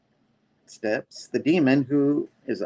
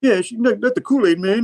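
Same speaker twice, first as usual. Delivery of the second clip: high squeaky cartoon voice; 11 semitones higher, 5 dB louder than usual. High voiced character reply.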